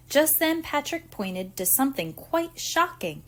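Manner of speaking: ordinary delivery, nothing marked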